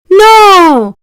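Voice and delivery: a sharp, loud, high refusal; cried out. A narrator's reading. Raja refusing his begging ministers, loud cry. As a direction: slam the door on the request. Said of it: "cried the Raja".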